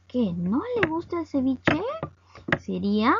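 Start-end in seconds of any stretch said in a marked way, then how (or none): 0.1-1.8 higher voice, rising to an incredulous end; acted surprise, pitched up; surprised question. Shown as a demonstration. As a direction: question it as if you can't believe it. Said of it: "lo que nosotros expresamos es sorpresa".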